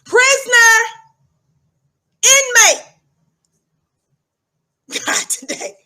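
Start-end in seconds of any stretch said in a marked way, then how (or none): 0.1-2.8 high, loud barked "Prisoner."; barked call. Shown as a demonstration. Guard addressing the inmate in the newsroom, barked call. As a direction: snap "Prisoner." out as a command. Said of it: "That's how I refer to it".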